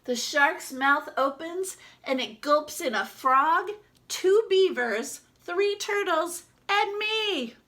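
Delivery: rising suspense. Acted. Shark gulps toys.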